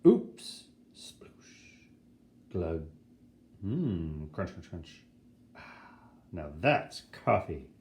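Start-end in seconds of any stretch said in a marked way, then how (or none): 0.0-0.6 mock oops. Fake mishap sound effects. 1.0-5.0 sound effects. Acted. Voicing sound effects of mishap.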